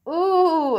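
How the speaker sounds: long, high, loud "ooh"; dismayed yell. A narrator's reading. Bug yells as his apple bounces away, loud high wail. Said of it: "yells Schubert".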